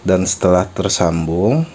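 ordinary delivery

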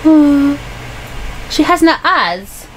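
ordinary talk

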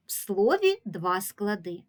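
ordinary delivery

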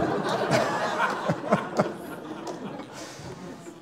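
long airy laugh at the punchline; breathy laugh. Real, not acted. Pastor laughing at Patty's kneecaps story, long airy laugh.

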